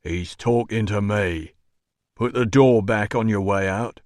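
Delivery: doorman's character voice; unimpressed doorman. A narrator's reading. Doorman ordering an intruder out, unimpressed.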